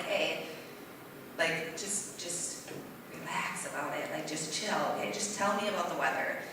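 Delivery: hushed, soothing mom talking her son down; soft calming. Retold or imitated. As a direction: soothe him down gently and quietly.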